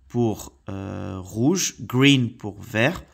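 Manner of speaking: slow, deliberate word-by-word teacher repetition. Shown as a demonstration. Teacher repeating colours for children, slow and deliberate. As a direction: spell it out slowly for the kids. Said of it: "Donc, je répète".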